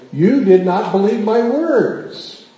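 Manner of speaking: slow, loud stern angel; stern rebuke. Acted. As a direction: lay down the rebuke slowly and firmly. Gabriel rebukes Zechariah.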